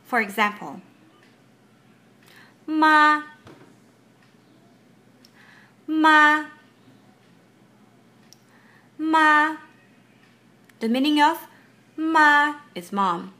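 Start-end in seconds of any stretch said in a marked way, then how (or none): 2.7-9.6 "ma" held high and level, louder; sustained high first-tone "ma". Shown as a demonstration. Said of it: "Your voice starts high and stays there". 12.0-12.6 "ma" drawn out on a high, level pitch; first-tone "ma", high and steady. Shown as a demonstration. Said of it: "Your voice starts high and stays there".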